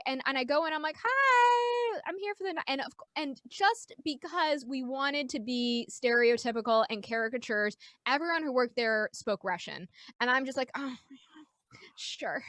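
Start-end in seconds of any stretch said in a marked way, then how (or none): 1.0-2.6 forced cheery greeting. Retold or imitated. Acting out a nervous cheery greeting. 10.8-12.4 hushed, dazed reaction; stunned undertone. Retold or imitated. Acting out dazed reaction to venue.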